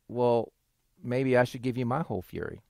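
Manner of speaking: low, soft reply as God; understated warning. Acted. God answering Mike's angry demand, quietly warning. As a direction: drop low and soft, a quiet veiled threat.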